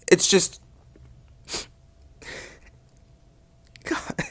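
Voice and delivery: halting, breaking off into a faint gasp; too overcome to finish, hammed-up; choked up. Reviewer overcome by a racing game, choking up. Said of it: "voice is choking up".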